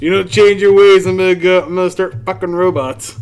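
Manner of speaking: loud, high mock ultimatum; mock threat. Warning women about robots, loud mock threat. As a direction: belt out a joking threat.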